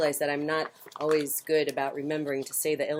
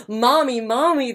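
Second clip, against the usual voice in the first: higher, louder joyful calls; owlets cry out; 6 semitones higher, 6 dB louder than usual. A narrator's reading. Baby owls greeting their mother, high and loud. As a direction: call out thrilled to see her. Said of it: "they cried".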